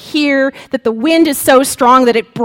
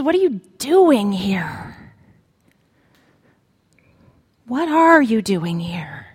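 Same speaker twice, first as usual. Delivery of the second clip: whispered question; 3 semitones lower than usual. Acted. Preacher voicing God in a whisper. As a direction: breathe the question gently.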